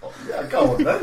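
high, giggling "go on then"; laughing. Real, not acted. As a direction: laugh while agreeing. Laughing accepting sushi.

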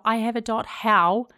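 ordinary talk, nothing marked

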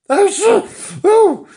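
very high, loud flustered oh; flustered cries. Acted. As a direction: squeak in dismay. Flustered Flange gasps.

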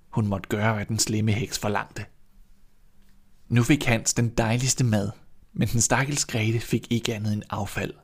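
usual speaking voice

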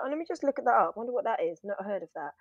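soft, idle thinking aloud; mumbled-to-self curiosity, trailing down in volume; musing to oneself. Retold or imitated. Retelling own private musing.

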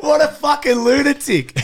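high, drawn-out exclamation; amused disbelief. Real, not acted. Host reacting to the album title, amazed outcry. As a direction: cry it out slowly, astonished.

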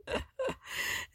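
a squeaky, airless burst of laughter; high breathless laugh. Real, not acted. Recapping Aaron sulking, she breaks into a high silent laugh.

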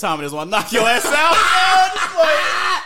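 high, loud, drawn-out mock taunt; shouted trash talk. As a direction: yell it like a hyped-up fighter's threat. Acting out a fighter's loud trash talk.

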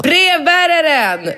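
high, drawn-out call down the stairs; shouting after the mailman. Retold or imitated. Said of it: "ropar jag".

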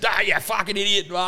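high-pitched mock telling-off; dad's scolding spray. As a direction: imitate an exasperated dad blowing up. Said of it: "I'll give you a big spray".

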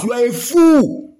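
slow angry insult. Real, not acted. Angry rant at judges.

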